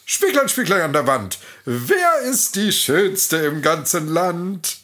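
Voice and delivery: queen addressing her mirror, self-assured; triumphant queen. A narrator's reading. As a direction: relish the question, sure of the answer. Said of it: "sprach siegesgewiss".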